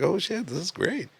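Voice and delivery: softly amazed, pitch lifted; retold stoned wonder, high and hushed; high amazement. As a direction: marvel quietly, voice going up. Retelling being high for first time.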